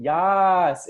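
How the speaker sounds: a big, loud, high "Yas" cheered out; excited cheer. Shown as a demonstration. Coach hyping the chat audience, loud cheering "Yas". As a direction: shout "Yas" like hyping up a crowd. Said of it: "type in".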